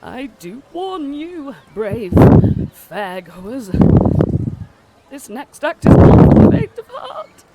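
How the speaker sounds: ringmaster's warning that climbs from high and tearful to a huge, loud cry; a weeping showman who builds to a booming, high announcement; dramatic circus announcer, rising to a loud high cry. Acted. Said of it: "as he's crying still, he's like".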